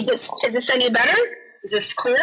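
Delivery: slightly glitchy call audio; a bit broken up over the line; garbled audio. Real, not acted. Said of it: "It's getting a little more clear".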